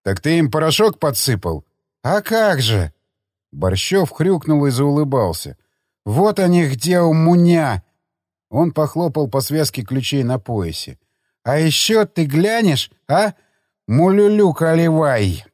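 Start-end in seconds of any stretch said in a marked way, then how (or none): 2.0-2.9 put-on thick-tongued drunk reply; drunken slur. Drunk assistant answering his drinking companion, drunken slur. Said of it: "На пьяном, на пьяном языке". 6.0-7.8 put-on drunken slur. Drunk assistant showing off his keys, drunken slur. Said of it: "На пьяном, на пьяном языке". 11.4-15.4 put-on garbled drunk with mangled words; drunken slur. Drunk assistant demanding another drink, drunken slur. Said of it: "На пьяном, на пьяном языке".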